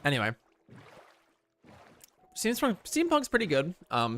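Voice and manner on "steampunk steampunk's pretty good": excited. Real, not acted. Enthusing about steampunk.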